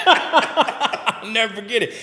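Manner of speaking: high laugh, then slow laughing words; laughing recollection. Real, not acted. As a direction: laugh it out, then savor the memory. Laughs recalling the moment.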